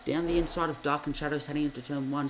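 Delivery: motorsport commentator. Acted. Live race play-by-play.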